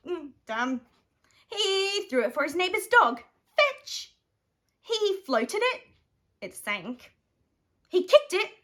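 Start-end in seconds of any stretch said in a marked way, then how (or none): 3.6-4.1 high bright call to a dog; eager high fetch call. Acted. Calling the dog.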